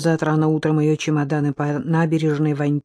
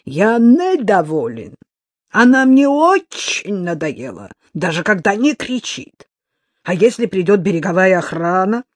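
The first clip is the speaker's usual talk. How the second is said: louder, angry complaint; 3 semitones higher, 6 dB louder than usual. A narrator's reading. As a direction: press it angrily.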